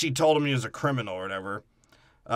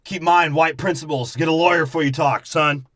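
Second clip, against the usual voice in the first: louder, higher mock fatherly advice; imitated dad; 4 semitones higher, 6 dB louder than usual. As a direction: lay down the law as a hammed-up dad. Voices dad lecturing his son.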